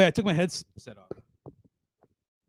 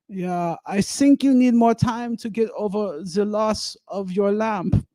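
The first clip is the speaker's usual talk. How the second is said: put-on slow, higher-pitched German-accented English; deadpan shopkeeper in a thick German accent; put-on German accent, slow and measured; Berlin German accent; 5 semitones higher, slower than usual. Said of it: "you know, Berlin German".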